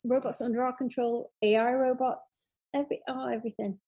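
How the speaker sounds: usual speaking voice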